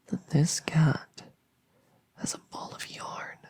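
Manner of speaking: breathy hush, drawing them in; spooky whisper. Acted. Monster under the bed to victim, whispered. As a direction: whisper it like a secret to scare. Creepy scary-scene setup.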